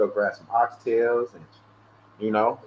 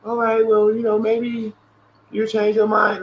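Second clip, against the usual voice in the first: higher, singsong mock salesperson; mimicking the telemarketer; 8 semitones higher, slower than usual. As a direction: parrot the pushy caller's pitch, pitched up. Imitating the telemarketer on phone.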